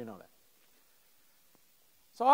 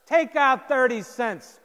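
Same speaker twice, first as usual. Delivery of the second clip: louder, slow and heavy; stern command; 7 semitones higher, 10 dB louder, slower than usual. Retold or imitated. Captain orders the jerk sophomore, slow loud command. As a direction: lay down the law, word by word.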